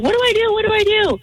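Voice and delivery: high, frantic what-do-I-do; mock hysterical plea; panicked retold cry. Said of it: "and I start crying".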